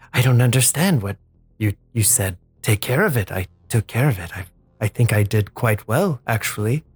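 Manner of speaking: low, calm oddball voice; Grin voice. Acted. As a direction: speak low and unbothered.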